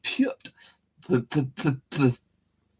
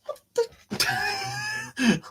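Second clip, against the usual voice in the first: very high startled cry; shocked squeal; 4 dB quieter than usual. Real, not acted. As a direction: shriek it in disbelief. Shocked at own slip.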